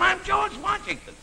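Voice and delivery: high-pitched crazed announcement; slow, high mock yell; yelling madman. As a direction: imitate a nut proclaiming himself to a busload. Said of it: "he's yelling".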